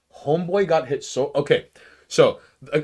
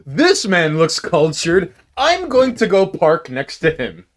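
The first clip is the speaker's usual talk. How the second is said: loud, higher, slowly drawn-out declaration; mock admiring passenger; 5 semitones higher, 7 dB louder than usual. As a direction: announce it grandly as the impressed passenger. Jokingly voices the passenger's thought.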